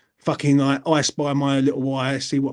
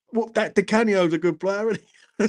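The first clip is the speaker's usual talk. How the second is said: higher, chatty Cockney granddad voice; imitated Cockney granddad; 6 semitones higher than usual. Grandson imitating granddad on West Ham players, Cockney accent. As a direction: chat football like a Cockney grandad. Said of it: "Cockney accent".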